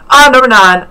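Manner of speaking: loud, high cry of delight at the rank; thrilled cry. Retold or imitated. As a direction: shout it out like you just won. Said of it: "I was like".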